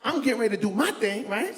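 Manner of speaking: usual speaking voice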